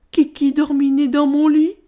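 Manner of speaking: high, loud cub whine; whimpering little bear. A narrator's reading. Ch'ti Ours finds someone in his bed, whining. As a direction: squeal it as an upset small bear. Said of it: "pleurchigna Ch'ti Ours".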